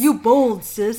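a hint of the brother, laugh creeping in; brother's voice. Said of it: "his voice".

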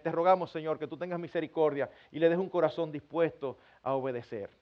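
low, softening prayer voice; parental prayer. Acted. Parent praying to God for little Pedrito, low earnest prayer. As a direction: pray humbly over a child, low and even.

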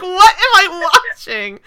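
high, loud, slow bewildered outcry; mock disbelief. Viewer reacting to opera after a spear attack, mock disbelief. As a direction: exclaim it in exaggerated bafflement. Acting out her baffled reaction.

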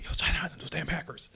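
a quick mock-secret whisper; whispered aside. Joking aside to co-host about hackers, whispered. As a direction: whisper it as a conspiratorial joke.

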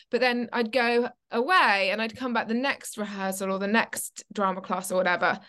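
usual speaking voice

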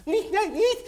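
high, loud squeaky protest; a little mouse insisting it isn't so; squeaky mouse denial. Acted. Mouse denying to Meis that he can talk, squeaky high protest.

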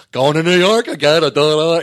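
loud, sing-song brag; mocking braggy friend. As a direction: show off the trip loudly. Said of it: "when he says".

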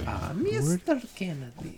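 put-on slow, high, thick-accented villain voice; lightly hammed game villain greeting; villain impression. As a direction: drawl the name like a sly little villain. Imitating Resident Evil villain.

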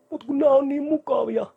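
mock whiny high voice of a lazy client. Coach mocking clients who won't give up comfort, whiny high voice. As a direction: pitch up high and whine like someone clinging to comforts.

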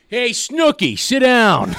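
bad Boston accent shouting. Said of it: "I'm gonna do a terrible Boston accent".

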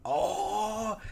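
long, high-pitched "oh" of awe; imitating an amazed moviegoer; re-enacted theater gasp. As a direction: draw out a rising, thrilled oh. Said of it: "I remember in the theater going".

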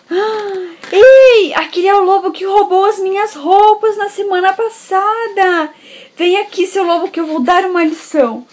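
high, loud, outraged; angry guard. Acted. Guard spotting the wolf who stole his clothes, loud and angry. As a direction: recognise the thief and charge him. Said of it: "Aí quando ele entra".

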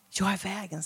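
softly reverent quote; Jesus's words, softly reverent; hushed quote. Shown as a demonstration. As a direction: say it low with awe. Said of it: "säger Jesus".

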